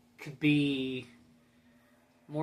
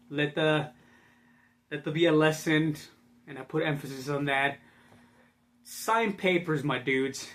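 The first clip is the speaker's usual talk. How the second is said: ordinary delivery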